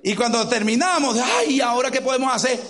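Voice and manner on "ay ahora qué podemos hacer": raised, louder cry of dismay; panicked cry. Retold or imitated. Retelling the family's panic over the flooded apartment, a loud cry of dismay. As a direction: throw up your hands and call it out.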